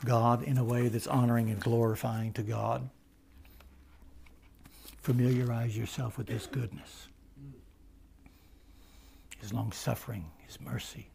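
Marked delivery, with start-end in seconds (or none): 5.0-11.1 softer and softer to a whisper; fading hush. Real, not acted. Preacher to congregation, fading to a whisper. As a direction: let it sink to a reverent hush. Soft fading closing list.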